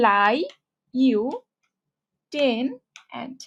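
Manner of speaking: "লাই, ইউ, টেন, অ্যান্ড" as separate syllables with long pauses; spelling pronunciation. Shown as a demonstration. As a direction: say each written chunk alone: "লাই", "ইউ", "টেন", "অ্যান্ড". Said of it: "আমি একটা ভুল উচ্চারণ শিখতেছি". ("লাই, ইউ, টেন, অ্যান্ড" বানান অনুযায়ী থেমে থেমে)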